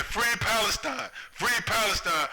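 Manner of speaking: high, loud anguished cry; retold scream. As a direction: shout it like the man burning.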